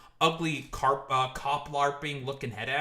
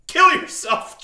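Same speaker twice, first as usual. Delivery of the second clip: mock threat; 13 semitones higher, 11 dB louder, slower than usual. Joking threat then just kidding.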